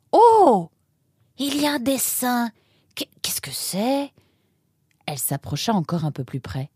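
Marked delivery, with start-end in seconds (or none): 0.1-4.1 high loud oh, then hushed, stammered question; gasp, then wonder in a high half-whisper; awed discovery. Acted. Discovers a drawing.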